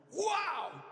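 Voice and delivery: high, drawn-out gasp of wonder; hammed-up astonishment; amazed wow. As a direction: act out astonished relief. Voices the debtor amazed at pardon.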